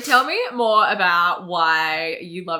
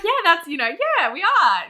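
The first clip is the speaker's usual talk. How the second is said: high, loud, airy agreement; breezy retold reply; 11 semitones higher, 6 dB louder than usual. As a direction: toss it off high, imitating an oblivious yes. Retelling her breezy reply.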